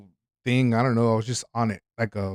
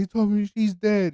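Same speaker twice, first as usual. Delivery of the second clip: mom distraught; 8 semitones higher than usual. Retold or imitated. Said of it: "she's like".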